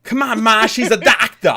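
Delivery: loud, high, hammed-up American accent; bad American accent. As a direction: shout it in a terrible American accent. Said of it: "American accent ... was sometimes pretty terrible".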